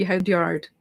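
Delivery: ordinary delivery, nothing marked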